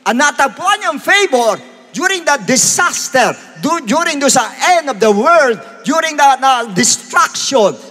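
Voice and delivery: high, emphatic preacher's delivery; full-force preaching. Real, not acted. Preacher tells of favor during disaster, full-force preaching. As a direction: punch out each phrase from the pulpit.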